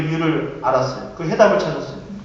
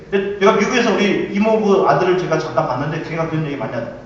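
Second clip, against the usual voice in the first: ordinary talk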